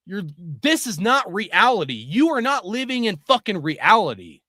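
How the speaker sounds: ordinary delivery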